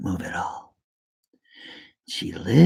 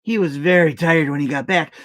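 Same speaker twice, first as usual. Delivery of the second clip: ordinary delivery, nothing marked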